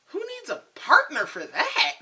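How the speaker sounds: high, loud rhetorical question breaking into laughter; loud laughing disbelief; incredulous outburst. Real, not acted. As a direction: throw it out loud and high, amused.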